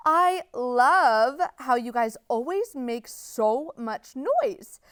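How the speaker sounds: ordinary delivery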